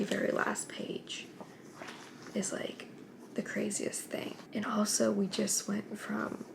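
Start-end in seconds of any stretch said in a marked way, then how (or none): 0.0-4.3 drawn-out hush about the last page; slow whisper. Real, not acted. Reader teasing the crazy final page to viewers, whispered. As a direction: lean in and whisper the secret. Late night, last chapter.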